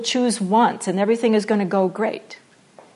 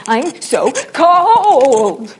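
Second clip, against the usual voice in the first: drawn-out, much louder hammy lament, very slow; bad Shakespearean actor; 4 semitones higher, 8 dB louder, much slower than usual. Shown as a demonstration. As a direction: stretch every word like a bad stage tragedian. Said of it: "you're just gonna get".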